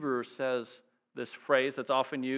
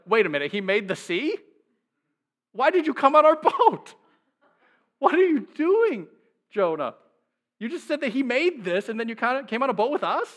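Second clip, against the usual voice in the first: much higher and louder, laughing disbelief; incredulous sailors; 8 semitones higher, 5 dB louder than usual. Retold or imitated. Sailors confronting Jonah on their boat, incredulous and high. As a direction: sailors cracking up at Jonah's nerve. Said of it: "can't you just imagine the sailors being like".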